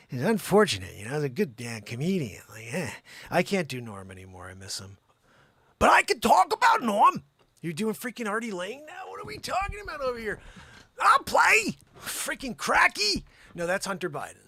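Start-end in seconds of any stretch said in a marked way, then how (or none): 5.8-13.2 much higher, louder shouted character voice; hammed-up shouting impression; loud comic impression. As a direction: blurt it like a wild comic.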